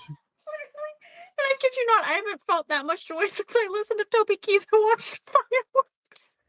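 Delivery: high, laughing talk that keeps breaking up; real giggles bubbling over the words; laughing through it. Real, not acted. Recounting a wild dinner to a friend, laughing.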